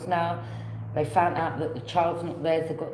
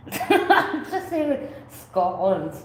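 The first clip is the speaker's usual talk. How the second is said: slow, higher words squeezed out through laughter; laughing; 5 semitones higher, 5 dB louder, much slower than usual. Real, not acted. Tarot reader still giggling while explaining what she hears, laughing.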